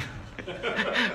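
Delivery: high laugh. Real, not acted. Laughing at his own joke.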